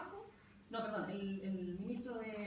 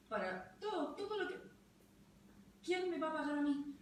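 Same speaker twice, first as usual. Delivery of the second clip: normal talking voice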